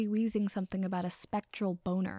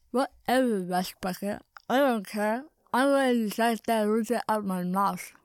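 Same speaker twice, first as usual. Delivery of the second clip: raised, put-on character voice, scornful; scoffing; 4 dB louder than usual. A narrator's reading. Vampire friend brushing off the others, loud scoff. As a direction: scoff loudly and dismissively. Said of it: "Katsuki scoffed".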